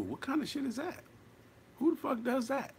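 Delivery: high, incredulous outcry; indignant disbelief. Real, not acted. Indignant rhetorical outburst.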